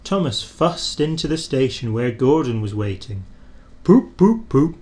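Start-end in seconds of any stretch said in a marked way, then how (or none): 3.8-4.7 loud cross hooting big engine. A narrator's reading. Gordon hooting crossly at late Thomas. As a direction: hoot impatiently at the little engine. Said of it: "said Gordon crossly".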